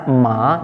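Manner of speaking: "ma" climbing upward; upward-sliding "ma"; rising tone. Shown as a demonstration. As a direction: lift "ma" straight up in pitch. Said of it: "langsung naik ke atas".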